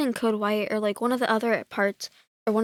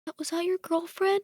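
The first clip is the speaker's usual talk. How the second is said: timid wife; 9 semitones higher than usual. Retold or imitated. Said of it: "she's all like".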